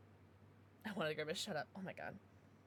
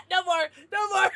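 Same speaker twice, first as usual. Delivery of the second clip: words through loud laughter; laughing; 8 semitones higher, 9 dB louder than usual. Real, not acted. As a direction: giggle out the protest. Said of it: "laughing at the tie".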